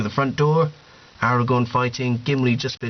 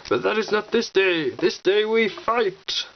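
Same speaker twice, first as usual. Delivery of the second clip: Gandalf speech; 8 semitones higher than usual. Retold or imitated. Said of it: "Gandalf's here saying".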